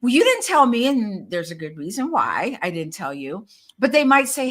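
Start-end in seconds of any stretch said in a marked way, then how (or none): 0.0-0.8 hurt friend. Retold or imitated. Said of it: "And they're like".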